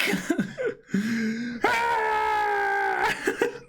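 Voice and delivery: shrieking high laugh that cracks into a cry; high wailing laugh. Acted. Character wails a laugh at own mistake, high cry. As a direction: wail it out as a laugh.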